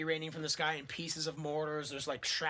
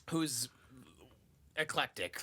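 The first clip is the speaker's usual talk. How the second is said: usual speaking voice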